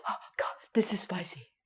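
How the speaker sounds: breathless whisper, mouth on fire; whispered, burning mouth. Acted. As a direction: gasp it out like your tongue is burning. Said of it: "You say".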